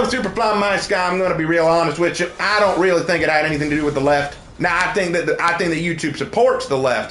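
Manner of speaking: put-on thick folksy Southern US accent. Streamer answering viewers on a dare, Southern accent. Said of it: "the rest of the stream as Southern as possible".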